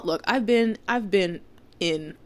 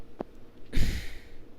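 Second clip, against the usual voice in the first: heavy exasperated sigh; 4 dB quieter than usual. Real, not acted.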